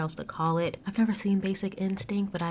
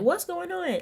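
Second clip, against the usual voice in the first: confused Margaret; 12 semitones higher than usual. Retold or imitated. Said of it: "Margaret was like".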